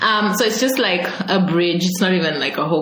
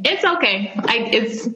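normal talking voice

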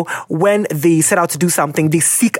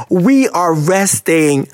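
ordinary talk

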